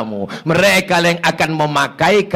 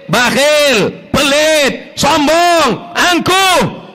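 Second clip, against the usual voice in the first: high, loud, teasing preacher; preaching at full force; 11 semitones higher, 3 dB louder, much slower than usual. Acted. Preacher teasing the husbands in the crowd, high and loud. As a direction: lay into the husbands from the pulpit. Preacher listing faults rising.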